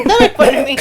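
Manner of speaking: laughing protest. Real, not acted. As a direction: protest while laughing for real. Teased, protesting through laughter.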